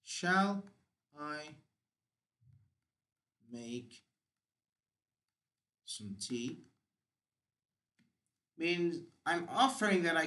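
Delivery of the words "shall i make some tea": word by word, long pauses between words; slow dictation. Shown as a demonstration. Teacher writing on the board, dictating the sentence word by word. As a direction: spell out "Shall I make some tea?" slowly for the class to copy. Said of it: "Let me write it".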